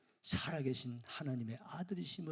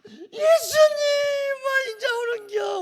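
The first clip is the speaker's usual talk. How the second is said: a loud, much higher Gyeongsang dialect cry; Gyeongsang dialect wail; 15 dB louder than usual. Retold or imitated. Martha, wailing in Gyeongsang dialect, reproaches Jesus for coming late. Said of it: "우리 경상도 말로".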